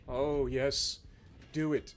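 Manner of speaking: very low, hushed relish; soft, deep egging-on; low gloating. Acted. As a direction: murmur it with quiet menace. Eggs on the opponent, gloating.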